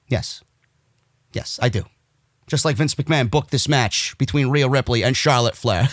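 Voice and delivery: low, quiet deadpan; deadpan sarcasm. Real, not acted. Host mocking McMahon's supposed booking, deadpan sarcasm. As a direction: drop the voice and play it straight. Deadpan joke before bursting out laughing.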